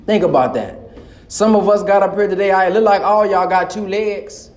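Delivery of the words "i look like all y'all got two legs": usual speaking voice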